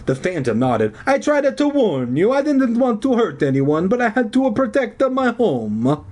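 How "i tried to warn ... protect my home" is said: high-pitched hammed-up Italian; Italian accent. A narrator's reading. Phantom explaining himself to the kids, Italian accent. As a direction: plead your case in stage-Italian. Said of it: "his hoarse Italian voice".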